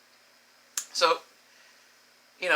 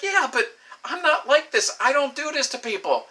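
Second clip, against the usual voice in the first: high, stretched mock insistence; upset protest; 10 semitones higher, 4 dB louder than usual. Woman insisting she doesn't do this to people, retold high upset protest. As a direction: imitate her pleading her case up high. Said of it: "she's legitimately upset".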